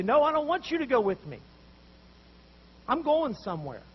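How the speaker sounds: loud, exasperated no; fed-up refusal. Retold or imitated. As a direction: shut them down, you're done with them.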